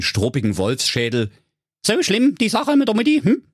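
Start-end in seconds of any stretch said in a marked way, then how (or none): 1.8-3.4 sympathetic owl doctor. A narrator's reading. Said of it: "fragte er einfühlsam".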